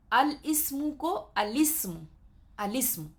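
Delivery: "الاسم" said slowly as alism, hamza dropped; slow, careful demonstration of الاسم; model reading. Shown as a demonstration. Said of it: "خیال رکھنی ہے".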